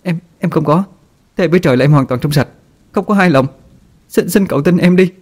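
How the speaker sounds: high, rushed, desperate plea; pleading. A narrator's reading. Wife begs her husband on her knees, high and desperate. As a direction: beg him to believe her, voice climbing. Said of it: "vội chắp tay cầu xin".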